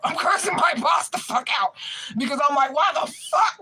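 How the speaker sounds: high, retold outburst at the boss; outraged cursing. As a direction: shout it in incredulous outrage.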